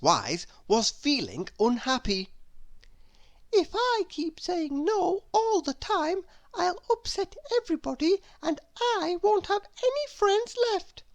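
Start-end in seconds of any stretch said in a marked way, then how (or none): 3.5-11.0 high, quick fretting to herself; high worried voice. A narrator's reading. Unhappy Little Miss Wise fretting about losing friends, high and quick. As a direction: rush the worry in a high voice. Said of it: "she said to herself".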